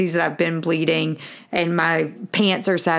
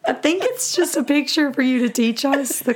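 laughing, high; 8 semitones higher than usual. Retold or imitated. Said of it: "And I'm like".

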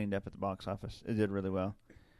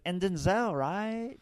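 put-on higher, uncertain teen girl question; lilting ditzy teen; valley girl voice; 7 semitones higher, slower than usual. Imitating the teen asking about Denzel, higher. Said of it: "This is how she talked".

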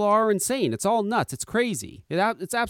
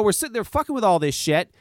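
usual speaking voice

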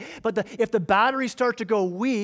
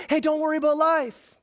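higher, airy brush-off; cushy breezy preacher; 6 semitones higher, 3 dB louder than usual. Pretend. Jet-owning preacher telling the poor not to worry, glib and higher. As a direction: toss it off like a rich televangelist. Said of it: "religious guy living a cushy life".

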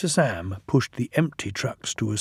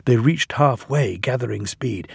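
ordinary delivery, nothing marked

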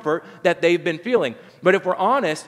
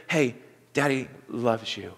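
tender; 6 semitones lower, 5 dB quieter than usual. Retold or imitated. God's secret to Simeon.

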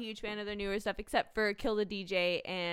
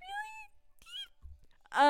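surprised really. Real, not acted. Disbelief at offer.

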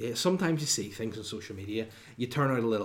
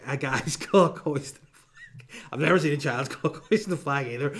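laughing; 3 semitones higher, 6 dB louder than usual. Real, not acted. Laughs at his own slip.

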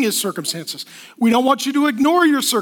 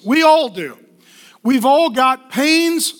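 ordinary talk, nothing marked

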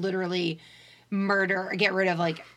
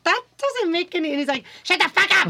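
higher, louder mock outburst; mock Cartman rage; 8 semitones higher, 4 dB louder than usual. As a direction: snap at the classmates like a bratty kid. Cartman snaps at the class.

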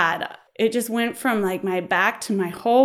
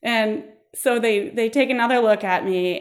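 normal talking voice

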